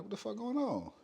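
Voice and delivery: shocked. Retold or imitated. Mother-in-law grabbing the walls.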